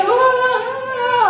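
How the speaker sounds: high, slow sung-out wordless whine; imitated child babble. Little brother whining for something, high drawn-out babble. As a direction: mimic a child wailing without words. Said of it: "dia ngomong nggak jelas apa gitu".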